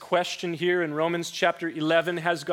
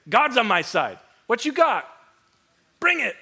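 bold, defiant taunt, rising higher at the end; cocky challenge; 5 semitones higher, 5 dB louder than usual. Pretend. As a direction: dare her to come at him. Imagined bold reply of Elijah.

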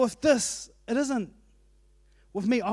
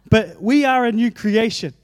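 louder, laughing through the words; 7 dB louder than usual. Real, not acted. Preacher laughing off a distraction to the congregation, laughing. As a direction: laugh it off and push on with the point.

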